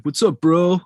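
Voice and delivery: a hint of a hammed-up American frat boy, tossing off the bro. Host imitating his British friend doing an American, in a mock frat-bro voice. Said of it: "he throws in bro a lot".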